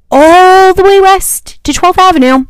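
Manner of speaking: drawn-out disbelieving exclamation; exasperated emphasis. Real, not acted. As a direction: stress how absurdly far it was. Exasperated at how far they walked.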